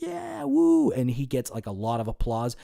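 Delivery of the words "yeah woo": high whooping cheer, mock crowd. Mimicking the crowd that erupted for Aaron, high cheer. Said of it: "They're like".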